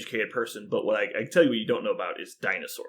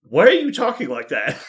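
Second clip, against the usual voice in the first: higher, louder retold mother's reproach; mock scolding; 6 semitones higher, 8 dB louder than usual. Mother catches daughter faking an accent, mock scolding. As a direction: act out an indignant mom with a laugh in it.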